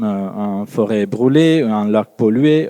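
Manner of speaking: ordinary delivery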